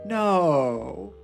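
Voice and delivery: usual speaking voice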